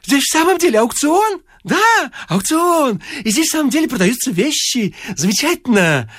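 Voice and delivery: very high, excitable bleat. A narrator's reading. Bouncing stranger delighted to find an auction, high bleating. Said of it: "Беспрерывно блея".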